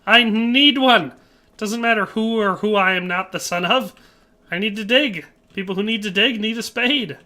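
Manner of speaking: hammed-up indignant rant; exasperated protest. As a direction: insist loudly, as if arguing back. Mock argument back about needing spade.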